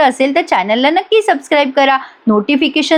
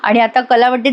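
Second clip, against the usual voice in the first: usual speaking voice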